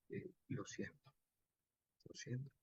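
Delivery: soft, whispered condolence; hushed awkward sympathy. Shown as a demonstration. Teacher voices a character awkwardly offering condolences, hushed. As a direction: mumble an embarrassed sorry. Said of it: "si este personaje habla así".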